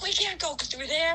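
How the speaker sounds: high-pitched mock panic; freaking out. Friend Jay facing the drop-off, imitated freaking out. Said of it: "Jay is freaking out".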